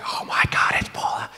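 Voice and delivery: excited stage whisper; starstruck whisper. Retold or imitated. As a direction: whisper it in awe, as if she might hear. Starstruck silent reaction, retold.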